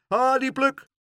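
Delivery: high, loud hail; called-out greeting. A narrator's reading. Bin men spot Pluk on the street, loud greeting. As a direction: call out to a familiar boy. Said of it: "riepen ze".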